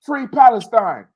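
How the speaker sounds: high, loud imitation of a man yelling; retold yelling. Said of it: "started yelling".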